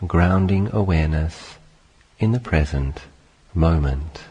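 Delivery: calm, unhurried meditation voice with long pauses; guided meditation. Acted. Teacher leading listeners through sitting and breathing, calm guided meditation. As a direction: guide listeners gently into stillness, phrase by phrase.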